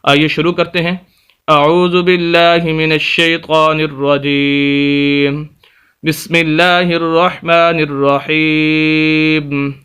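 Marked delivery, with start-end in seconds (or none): 1.5-9.7 slow, measured chanted recitation; solemn tilawat before the lesson; Quranic recitation. Acted. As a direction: recite it slowly, drawing out each phrase. Opens with the ritual recitation.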